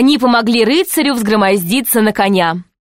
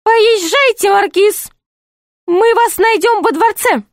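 very high jester voice; high-pitched jester giving orders; commanding jester; 12 semitones higher than usual. Acted. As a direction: send the marquis off in a piping pitch.

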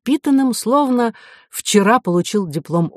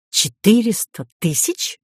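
suspicious echo, pitched higher as a question; 5 semitones higher than usual. A narrator's reading. Professor querying the price of the ticket, suspicious. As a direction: repeat the sum in open doubt, voice raised in pitch. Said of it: "Профессор не скрывал подозрений".

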